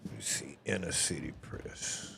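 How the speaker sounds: whispering to himself while searching; a breathy under-the-breath read; reading the site name in a whisper. Real, not acted.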